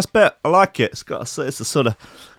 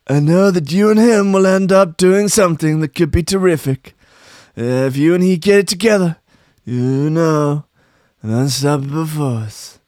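Trump impression; 4 semitones higher, 3 dB louder than usual. Trump urging Farage on a pact, higher boastful voice. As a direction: sell the deal like Trump. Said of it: "Trump told Farage".

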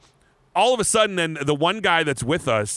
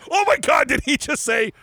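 surprised disbelief; 10 semitones higher than usual. Retold or imitated. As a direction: shout in amazed delight. Disbelieving reaction to German reply.

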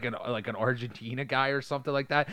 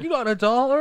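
high, loud cadging voice; mock begging bum; 14 semitones higher, 7 dB louder than usual. Bum begging through the window, mock high holler. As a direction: imitate a bum butting in for change.

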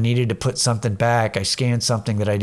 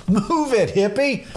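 much higher-pitched mock call-out; mock loud blurt; 10 semitones higher, slower than usual. Shopper blurting at a hippie in public, mock loud call. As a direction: re-enact calling out without being truly loud. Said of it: "it was really loud".

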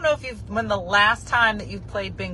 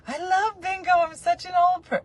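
high, much higher sing-song gush; mock gushing; 11 semitones higher, 3 dB louder than usual. Teasing herself as an old bingo fan, high and gushing. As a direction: play up a giddy bingo lover.

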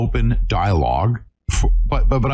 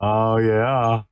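usual speaking voice

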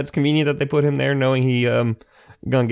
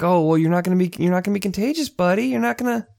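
put-on babying dad; 10 semitones higher, faster than usual. Dad placating son on phone.

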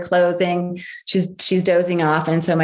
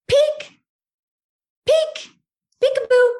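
put-on much higher, sing-song little child; toddler playing. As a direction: play peekaboo like a two-year-old. Toddler voiced playing peekaboo.

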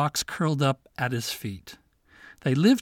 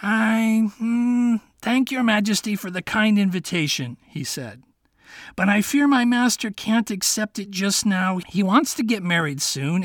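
higher, halting fox; feigned hesitation; 5 semitones higher, 3 dB louder than usual. Acted. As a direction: pretend to hesitate politely. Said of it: "he pretended to hesitate".

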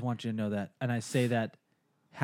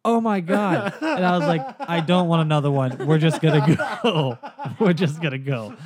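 laughing exasperation; 4 semitones higher, 7 dB louder than usual. Retold or imitated.

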